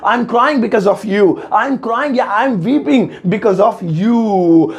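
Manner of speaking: slow, careful example read; slow model sentence. Shown as a demonstration. Teacher giving the English example, slowly. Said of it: "इसकी English हो जाएगी".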